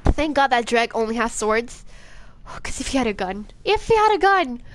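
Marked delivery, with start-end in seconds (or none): 3.6-4.6 high, raised exclamation; emphatic repeat. Real, not acted. Player imagining the Dreg with a gun, high emphatic cry. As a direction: throw it up high for dramatic emphasis.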